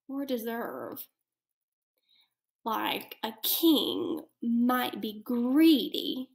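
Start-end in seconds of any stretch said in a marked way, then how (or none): 2.6-3.2 whispering. Real, not acted. Teacher aside.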